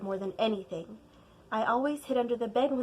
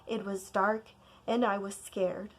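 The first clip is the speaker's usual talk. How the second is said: ordinary delivery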